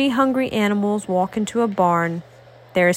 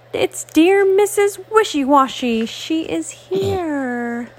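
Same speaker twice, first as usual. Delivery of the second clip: excited cheer; 7 semitones higher than usual. Acted. Animals cheer for rescuer.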